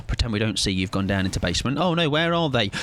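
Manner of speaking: usual speaking voice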